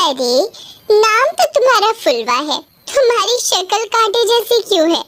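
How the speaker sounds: put-on high, quick taunting girl's voice; mocking cousin. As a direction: sneer at her looks with fake sweetness. Cousin mocks Fulwa's looks. (चचेरी बहन फुलवा का मज़ाक उड़ाती, ताना मारती ऊँची आवाज़)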